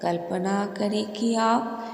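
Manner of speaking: soft, measured meditation voice with long pauses; guided meditation. Real, not acted. Guide leads listeners to the light in the heart, soft meditation voice. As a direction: guide them gently, phrase by phrase.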